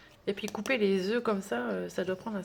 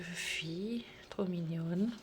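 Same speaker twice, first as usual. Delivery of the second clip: whisper; 2 semitones lower, 5 dB quieter, slower than usual. Real, not acted. Cooing over a sticker.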